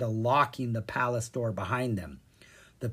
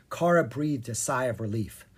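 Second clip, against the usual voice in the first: ordinary delivery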